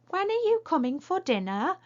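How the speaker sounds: high, loud put-on voice for Bill; silly voice. Shown as a demonstration. Teacher reading Bill's line to pupils in a silly voice. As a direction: squeak the question out like a cartoon Bill. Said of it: "remembers how they use their tone of voice".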